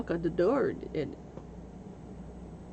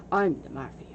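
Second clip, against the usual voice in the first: low, mock gangster line; mock tough guy; 7 semitones lower than usual. The fake mafia man at a door, mock tough. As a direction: puff up like a fake mobster. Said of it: "introduces himself as".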